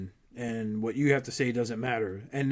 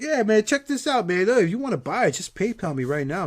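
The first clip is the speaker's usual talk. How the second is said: higher, eager hustler's pitch; pushy seller; 7 semitones higher, 4 dB louder than usual. Retold or imitated. Stranger hawking toys to a Walmart shopper, pushy. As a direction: imitate a guy pressing for a quick sale.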